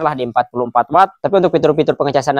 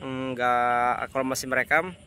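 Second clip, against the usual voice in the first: recorded on a phone mic outdoors; distant, quieter phone-mic sound; phone mic recording; 7 dB quieter than usual. Real, not acted. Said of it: "suara langsung dari mikrofon H P-nya".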